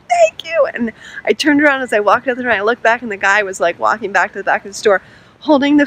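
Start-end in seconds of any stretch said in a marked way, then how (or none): 0.1-0.7 very high, imitated grateful squeal; retold gushing thanks. Register worker thanking the vlogger, retold gushing thanks. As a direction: mimic her thanks, pitched way up.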